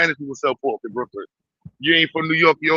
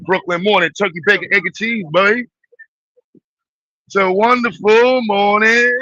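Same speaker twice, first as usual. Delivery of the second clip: higher, sing-song breakfast greeting, slowing at the end; cheery Brooklyn morning voice; 6 semitones higher, 4 dB louder than usual. Pretend. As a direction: greet the morning like a happy deli regular.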